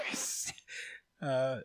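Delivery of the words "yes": excited whispered yes; barely withheld excitement. Acted. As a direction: whisper it as if holding in glee. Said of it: "He cannot withhold the excitement".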